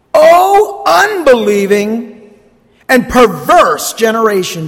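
frustrated. Acted. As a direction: read it as Jesus at the end of his patience. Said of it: "You see the frustration in Jesus' voice?".

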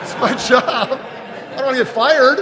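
high, laughing self-mockery; laughing mock dutiful pastor. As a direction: chuckle through the reluctant excuse. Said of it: "do I say".

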